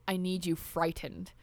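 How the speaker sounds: lightly acted menace, low and slow; soft, slow threat, lightly voiced; menacing demon girl. Quoting the film's demon girl.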